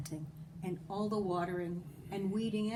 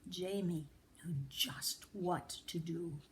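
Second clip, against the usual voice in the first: usual speaking voice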